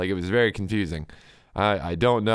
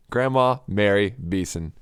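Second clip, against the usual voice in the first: ordinary talk, nothing marked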